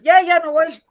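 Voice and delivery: high, loud and gushing yes; mock eager Cardiff. Cardiff accepting a bid, in a high mock-eager yell. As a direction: play Cardiff jumping at the offer. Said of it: "Cardiff's like".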